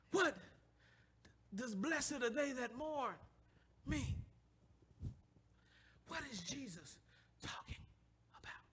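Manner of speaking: soft, questioning murmur of blessed are they that mourn; quiet musing. Acted. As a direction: ponder blessed are they that mourn under your breath. Quotes scripture then ponders it.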